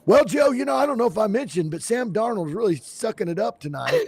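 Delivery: high, mocking TV color commentator; announcer impression. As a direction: ham up a clueless announcer. Mocking announcer Troy Aikman.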